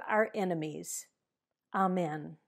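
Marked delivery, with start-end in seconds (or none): none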